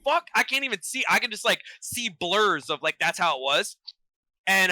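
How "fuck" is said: much higher-pitched disbelief; mock stunned reaction; retold bewilderment. Retells confusion at armed police.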